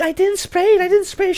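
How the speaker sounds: put-on very high, whiny girl voice; very high whiny teen girl denying it to the teacher; mock girl denial. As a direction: deny it like a caught teenage girl. Girl denies spraying perfume.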